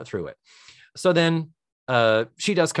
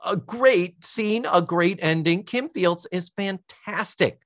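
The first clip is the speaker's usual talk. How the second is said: ordinary talk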